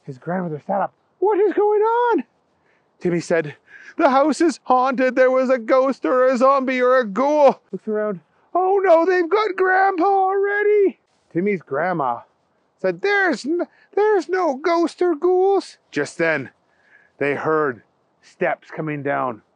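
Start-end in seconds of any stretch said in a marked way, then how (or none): 1.2-2.2 put-on high, alarmed old woman's voice; sit bolt upright and demand answers; startled grandmother. Grandma woken in the night, high and alarmed. 4.0-11.0 put-on high, loud panicked child; frightened boy. Timmy to his grandma, high and panicked. 13.0-15.8 put-on high, slow soothing old woman; slow it down to calm the boy; reassuring grandmother. Grandma calming frightened Timmy, high and slow.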